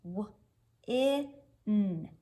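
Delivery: W, I, N, each sound said apart. Shown as a demonstration. Teacher taps the word on her arm, sound by sound. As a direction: tap out "W, I, N" one sound at a time. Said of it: "let's tap it".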